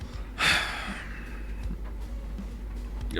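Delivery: long, quiet breathy sigh; soft, slow exhale of exasperation. Real, not acted. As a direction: let out a drawn-out sigh. Long sigh after swearing.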